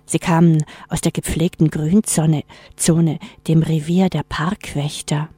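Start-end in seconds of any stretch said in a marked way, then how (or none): none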